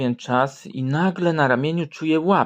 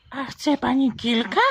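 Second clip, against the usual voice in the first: put-on high cheeky boy; 11 semitones higher than usual. Jasiu offers photos.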